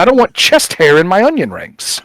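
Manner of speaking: half-acted angry shout; mock furious retort. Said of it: "just furious about it".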